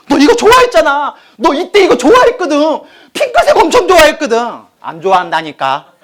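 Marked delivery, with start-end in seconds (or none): 0.1-4.4 high, loud, insistent mother voice; a mother pushing pink on her daughter, pitched high. Retold or imitated. (높고 크게 우기는 엄마 목소리)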